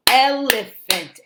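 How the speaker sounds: "elephant" slowly as el-e-phant, first beat strongest; syllable by syllable. Shown as a demonstration. Teacher modelling a new word, "elephant" slowly in syllables. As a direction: stretch "elephant" into three clear beats. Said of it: "teaches them how to say the words".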